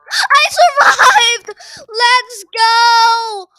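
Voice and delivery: loud, high victory yell; cheering his own survival; triumphant shout. Real, not acted. Survives the chase.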